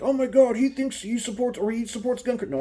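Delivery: high, loud mock gasp of outrage; mock shocked viewer. Vlogger imitates outraged viewers, high loud mock shock. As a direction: play a scandalized viewer. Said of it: "some of you are like".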